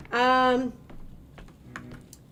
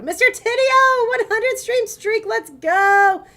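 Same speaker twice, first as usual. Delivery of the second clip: hype greeting; 12 dB louder, slower than usual. Real, not acted.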